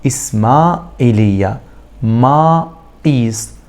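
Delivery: إسماعيلية and ما إيس said slowly, syllable by syllable; slow, split syllables. Shown as a demonstration. Teacher repeating with students, slowly splitting the words. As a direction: drag out ما إيس with a gap. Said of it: "تاني مع بعض".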